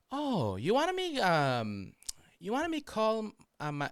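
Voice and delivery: high, drawn-out put-on voice; imitated coworker. Coworker offering the driver a favour, high mock voice. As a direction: play the coworker high and halting. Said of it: "And she goes".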